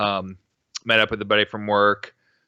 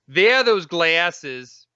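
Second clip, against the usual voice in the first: slow, louder, oddly stressed reading; odd slow drawl; 5 semitones higher, 4 dB louder, much slower than usual. Retold or imitated. Husband imitating his girlfriend's weird delivery, slow sing-song. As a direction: stretch it out slowly in a strange sing-song. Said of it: "What did you just say?".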